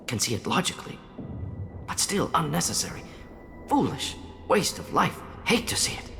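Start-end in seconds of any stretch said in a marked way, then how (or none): 1.9-6.1 high, pained outburst in short pieces; upset, halting and appalled; disturbed. Acted. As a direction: pitch leaps up in distress. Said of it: "to be disturbed by the sight".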